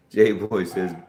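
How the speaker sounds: usual speaking voice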